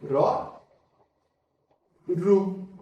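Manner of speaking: ordinary talk, nothing marked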